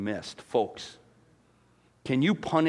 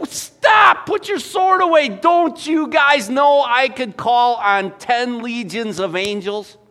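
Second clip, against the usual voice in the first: very high, loud rebuke, slowing down; Jesus stopping Peter with a loud, slowed cry; commanding shout; 9 semitones higher, 9 dB louder, slower than usual. Acted. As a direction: bellow it like a commander halting a fight.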